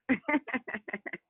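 short high-pitched laugh; a quick high giggle. Real, not acted. Laughs after dry humor remark.

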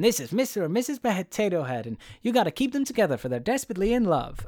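put-on high, earnest introduction for a child; playful toy introduction. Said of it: "and he goes".

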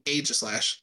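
AEGISLASH starting with long A as in "age"; own pronunciation. Shown as a demonstration. Explaining by letter combination, long-A "Aegislash". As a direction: say "Aegislash" as AY-jis-lash, falling. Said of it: "That's why I say it that way".

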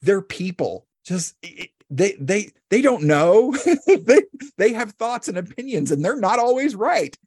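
high, half-laughing disbelief; jarring realization. Retold or imitated. Realizing her parents are human, high and half-laughing. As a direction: discover with a gasp that they are only human. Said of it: "such a jarring thing to be like".

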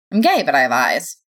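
plain reading voice

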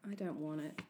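low, soft reluctance; sad. A narrator's reading. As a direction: say it quietly and sadly. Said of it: "I say sadly".

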